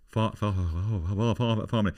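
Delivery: lightly voiced posh man reluctant to say it; hesitant posh man. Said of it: "And he went like this".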